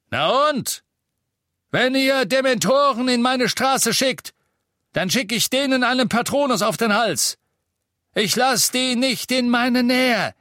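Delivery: high, loud angry rant; bellowing. A narrator's reading. Innkeeper warning Death Eaters off his street, bellowing. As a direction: roar defiance at intruders. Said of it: "brüllte er in eines der vermummten Gesichter".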